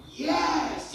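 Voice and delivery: "Yes" tinggi dan keras; jawab lantang. Retold or imitated. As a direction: jawab yakin walau bingung. Menjawab tanpa tahu artinya. (menjawab bapak Taiwan dengan lantang)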